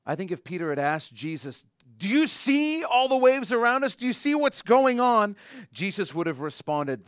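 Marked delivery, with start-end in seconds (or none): 2.0-5.4 high, loud alarmed asking; panicked question. Retold or imitated. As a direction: Peter pointing at the waves in a panic. Peter asks Jesus in alarm.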